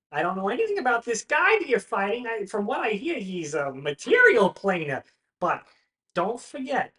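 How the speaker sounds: high, loud pep talk; coaching friend. Acted. Paxton coaches Branwen before her fight, high and loud.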